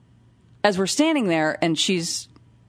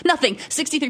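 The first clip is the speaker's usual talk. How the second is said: ordinary talk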